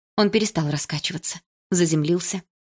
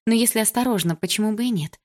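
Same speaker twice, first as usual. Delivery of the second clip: ordinary delivery, nothing marked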